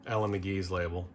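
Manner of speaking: usual speaking voice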